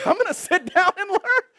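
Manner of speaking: high, loud, gushing newcomer; hammed-up carefree delight; delighted sister. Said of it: "The sister comes in".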